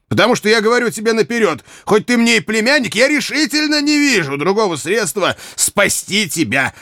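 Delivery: high, emphatic scolding; cross. A narrator's reading. Uncle warning his nephew of the gallows, angry. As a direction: warn him sternly, pitch pushed up. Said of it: "сердито сказал его дядя".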